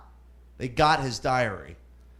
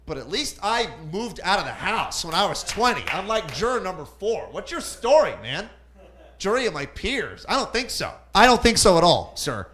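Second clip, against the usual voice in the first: indignant defendant; 5 semitones higher, 4 dB louder than usual. Acted. Defendant scorns juror in court.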